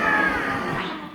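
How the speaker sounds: loud roar with tongue out; lion roar. Shown as a demonstration. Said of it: "Let's go".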